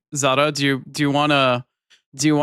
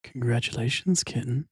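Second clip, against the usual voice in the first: sultry voice; 4 dB quieter than usual. Pretend. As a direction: purr it out softly. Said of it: "like, sultry voice".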